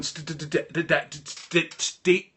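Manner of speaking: a retold stammer, stuck on d; high, excited stammer that can't get the words out. Overwhelmed caller stammering at friend on phone, stuttering.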